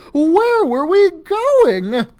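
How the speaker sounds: high, loud, slow scared cry; panicked high wail; frightened wail. A narrator's reading. Dangling from hook, frightened.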